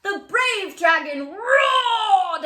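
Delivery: high, loud, slow roaring line; booming roar read. Acted. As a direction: stretch out roared like a big beast. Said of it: "booming voice".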